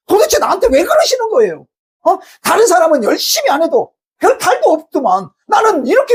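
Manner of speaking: high-pitched, pleading outrage; aggrieved protest. Acted. Believer demanding of God why only they suffer, aggrieved protest. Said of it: "하나님께 이렇게 눈에 뵈는 게 없어가지고".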